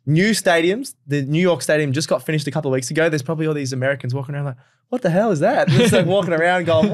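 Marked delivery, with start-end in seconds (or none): 4.9-6.9 light put-on American voice, laughing; American accent. Imitating an American tourist in a light American accent. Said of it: "I don't know why I just did an accent there".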